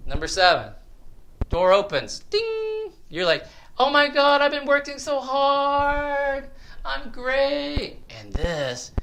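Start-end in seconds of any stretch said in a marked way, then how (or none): 2.3-2.9 high imitated chime; ping it like a lift bell; short high bell imitation; elevator ding. 3.8-8.0 high, drawn-out mock elation; hammed-up delighted patient; self-satisfied patient. Voices a self-satisfied seventh-floor patient.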